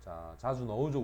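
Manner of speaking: normal talking voice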